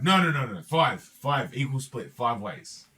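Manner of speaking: acted alarm, rapid-fire repeated words; snapping out of it. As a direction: burst in with urgent protest, then settle slightly. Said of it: "snaps out of it".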